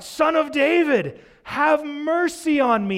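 high, loud, slow desperate wail. Retold or imitated. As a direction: beg at the top of your lungs. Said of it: "He continues to cry out".

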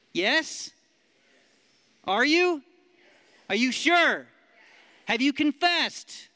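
high, loud challenging questions; fired-up questions. Real, not acted. Preacher challenges the room, high and loud. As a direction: push the crowd to answer.

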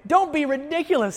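high, incredulous acted rebuke; mock exasperated dismissal; dismissive king. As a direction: scoff at the boy's offer. Acting out Saul dismissing David.

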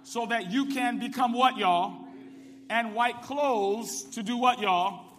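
loud, rising, call-and-response preaching; preacher calling the congregation to answer, loud; full-force preaching. Acted. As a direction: drive the scripture home to the church.

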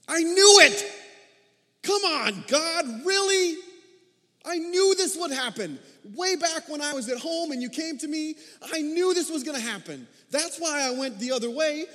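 loud, high, furious outburst; exceedingly angry. Acted. Preacher voicing Jonah's angry prayer, furious shout. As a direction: shout it at God in a rage. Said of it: "let's read it the way that I think Jonah was feeling".

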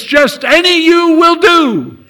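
shouted. Retold or imitated. Shouted punchline.

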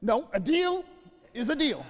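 high, loud insistence; insistent. Retold or imitated. Father refusing the son's excuse, high and loud. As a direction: press the point, won't budge.

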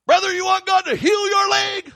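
high, loud shouted altar call; wild evangelist. Retold or imitated. Preacher imitating the evangelist calling the one-legged man, high shout. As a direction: call out to the man like a frenzied healer. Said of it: "he's crazy".

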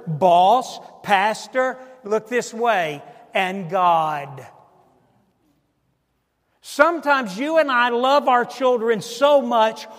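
preaching at full force. Acted. Preacher confronting parents who coddle, full force then slow. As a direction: build to a slow, pleading peak.

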